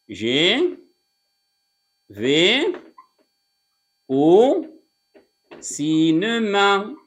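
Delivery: slow, stretched words with long gaps. Shown as a demonstration. Teacher building an example sentence for students, slow word-by-word delivery. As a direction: dictate it, drawing out each word.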